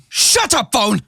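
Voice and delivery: sudden loud, high snap; snapping at phone. Real, not acted. Vlogger cut off by his phone, snaps at it loudly. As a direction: bark at the ringing phone.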